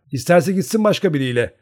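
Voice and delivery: put-on a proud girl dismissing her suitor; haughty. A narrator's reading. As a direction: toss it off with pride. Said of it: "kurumlu kurumlu".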